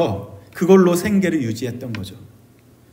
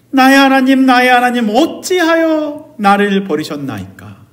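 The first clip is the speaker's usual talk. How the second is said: higher-pitched crying out, slowing toward the end; anguished cry; 5 semitones higher than usual. Acted. Preacher voices Jesus' outcry on the cross, anguished cry. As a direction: cry out in agony, then draw out the question. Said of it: "이 고통스러운 절규의 예수님의 모습, 그 소리, 그 외침".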